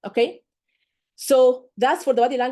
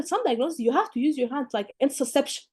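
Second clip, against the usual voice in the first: ordinary talk, nothing marked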